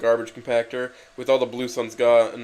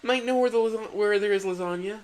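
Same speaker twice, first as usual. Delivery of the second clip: serious tone; 11 semitones higher than usual. Acted. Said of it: "said Miranda to Garfield in a serious tone".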